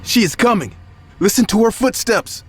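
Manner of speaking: very high, frantic terrified cry. Acted. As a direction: cry out in sheer terror, pitched high. Terrified man hears ghost.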